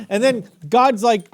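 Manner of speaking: usual speaking voice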